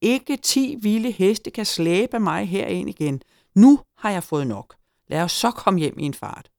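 high, emphatic tirade; exasperated refusal. A narrator's reading. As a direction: declare you have had enough. Gnome fed up, refuses to return.

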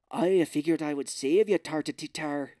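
mock Irish accent, loud on the opening line; hammed-up bad Irish brogue; terrible Irish accent. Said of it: "he's got a terrible Irish accent".

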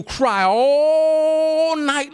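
"all" stretched very long on a high held note; long sustained "all"; drawn-out emphasis. Retold or imitated. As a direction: hold the word "all" as if it lasts the night. Acts out length of crying.

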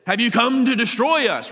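high, loud acted yell. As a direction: yell it out like a disturbed intruder. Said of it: "and then yelling out this".

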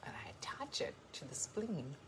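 very soft, near-whispered musing; hushed imagined voice. Acted. Woman voicing what the watcher is thinking, hushed musing. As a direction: murmur it like private scheming, slowing into pauses. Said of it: "She's like".

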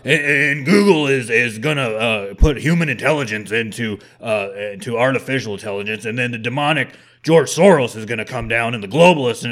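mock Alex Jones ranting about the globalists; imitating Alex Jones. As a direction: ham up a radio ranter's doom warning. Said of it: "He's all talking about".

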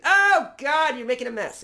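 high, loud, disgusted fuss; fussy stylist dismayed. Retold or imitated. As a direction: squeal at the mess being made. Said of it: "he's like".

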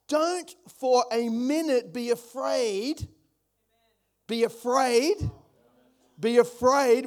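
preacher building to a loud Be afraid. Real, not acted. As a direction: hammer the word afraid home. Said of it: "We're gonna park on that word".

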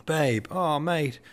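high gushing reunion cry; excited exclaim. A narrator's reading. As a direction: squeal with delight at a friend. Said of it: "they suddenly exclaimed".

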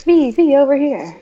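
high, loud photographer calling out; paparazzi yelling. Retold or imitated. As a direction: shout to get V to turn. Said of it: "He'd be yelling".

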